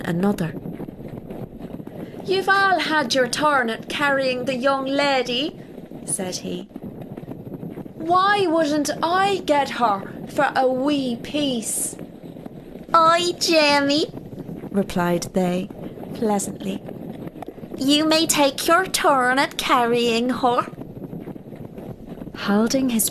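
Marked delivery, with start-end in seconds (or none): 2.2-5.6 high, airy fairy-folk voice; a sly elf teasing his companions. Acted. Elf speaks in tale. 7.9-14.1 high, slow, wheedling fairy voice; high elf voice. Acted. Elf and elves answer. 17.8-20.7 high, friendly fairy voice; the elves kindly granting a turn; pleasant high elf voice. Acted.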